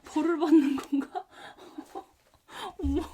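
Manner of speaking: laughing while talking. Real, not acted. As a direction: laugh through the words. Laughs at her own bad luck.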